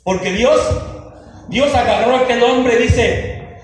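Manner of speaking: loud, driving sermon delivery; fiery preaching. Real, not acted. Pastor rebukes the flock for neglecting the Bible, fiery preaching. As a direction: preach it hard to the congregation.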